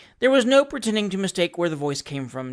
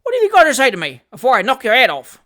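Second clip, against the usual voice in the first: loud, higher, fast menacing demand; threatening demand; 6 semitones higher, 9 dB louder, faster than usual. Acted. Sam threatens Trotter for an answer, menacing demand. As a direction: press him hard, fists ready. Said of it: "in a threatening manner".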